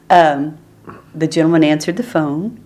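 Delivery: ordinary delivery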